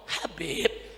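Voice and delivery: soft, low coy call; mock effeminate greeting. Preacher mimicking a man greeting the Habib, coy soft voice. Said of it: "Ngomongnya begitu".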